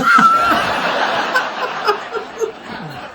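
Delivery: high squealing laugh. Real, not acted. Joker squealing at his square crisps line, laughing.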